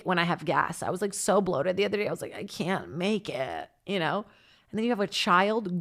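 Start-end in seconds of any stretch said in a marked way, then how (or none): 2.3-3.7 mock suffering. Retelling being painfully bloated.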